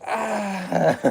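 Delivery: high loud laugh breaking out; real laughter. Real, not acted. Storyteller laughing at his own dumb story, real laughter. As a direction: crack up at the story.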